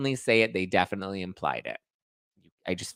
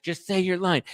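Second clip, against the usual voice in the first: mock demanding; 9 semitones higher than usual. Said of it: "she's like".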